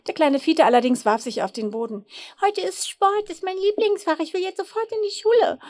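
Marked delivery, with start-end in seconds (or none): 2.4-5.6 put-on much higher, protesting little-boy voice; yelling child's tantrum. A narrator's reading. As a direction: wail and demand like a small child on the floor. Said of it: "brüllte er".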